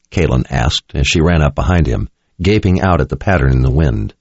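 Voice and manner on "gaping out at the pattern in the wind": ordinary delivery, nothing marked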